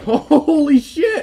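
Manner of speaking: high, loud, drawn-out yell; excited shout. Real, not acted. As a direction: stretch it out in amazement. Reacting to a wild play.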